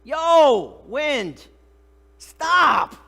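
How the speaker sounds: huge high-pitched commanding shout; full-force acted yell, word by word. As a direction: yell an order into the storm. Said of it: "he yells to it".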